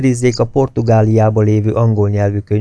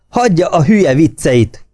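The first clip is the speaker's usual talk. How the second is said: higher, louder snapped yell; angry shout; 7 semitones higher, 4 dB louder than usual. A narrator's reading. Officer yelling at a subordinate to drop the jokes. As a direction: cut his joke off in fury. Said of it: "ordította Bug".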